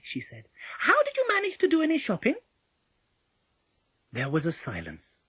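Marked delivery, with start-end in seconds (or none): 0.8-2.4 put-on very high child's voice; Polly, testing. A narrator's reading. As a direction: question the impostor pointedly.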